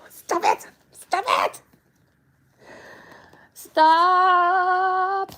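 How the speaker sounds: high exasperated yell at the dog; rising frustrated scolding. Real, not acted. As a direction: get the dog off the shoelace. Dog chewing her shoelace.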